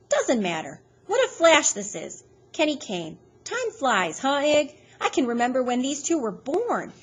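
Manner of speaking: giddy woman gushing about the past. A narrator's reading. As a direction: bubble over with old memories. Loretta gushing nostalgically.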